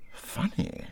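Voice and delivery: breathy puzzled whisper. A narrator's reading. Rat whispers to himself over the strange cut. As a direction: murmur it to yourself, puzzled.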